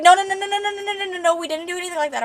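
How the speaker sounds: high rapid-fire string of denials; frantic denial. Acted. Man frantically denies they slept together.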